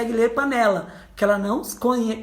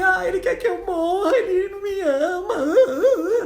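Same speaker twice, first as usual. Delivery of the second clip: crying mother; 9 semitones higher than usual. Retold or imitated. Hurt mother cries that her son doesn't love her, sobbing high. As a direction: wail it as a heartbroken mother imitated in tears. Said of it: "E aí a mãe".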